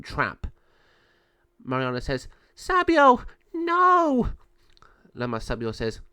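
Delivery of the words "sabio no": high, loud desperate call; distressed cry. Acted. Mariana seeing Lama Sabio trapped, distressed cry. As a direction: cry out in horror for a friend.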